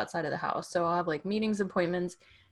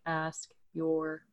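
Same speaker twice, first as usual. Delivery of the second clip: weary brush-off; 2 semitones lower, 5 dB quieter, much slower than usual. Retold or imitated. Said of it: "I'll just be like".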